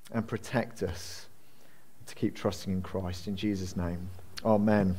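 low, hushed public prayer. Real, not acted. Minister asking the Father for help before preaching, prayerful.

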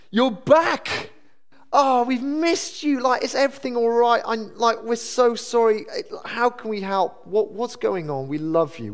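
high-pitched, gushing welcome; delighted welcome. Acted. Churchgoer greeting a girl returning to church, delighted and concerned. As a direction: act out overjoyed concern, pitched right up and easing down.

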